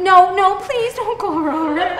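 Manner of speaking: high, whiny begging; hammed-up helpless plea; mock desperate pleading. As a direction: beg the phone to stop. Begging phone not to call ex.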